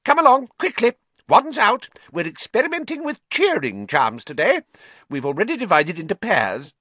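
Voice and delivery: put-on squeaky little professor hurrying late boys; tiny professor. A narrator's reading. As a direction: bustle them to their seats. Flitwick voiced in class.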